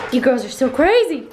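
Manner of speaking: high, loud playful pet talk; doggy talk. Real, not acted. Owner playfully scolding her dog Nolly, high and loud. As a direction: gush over the dog.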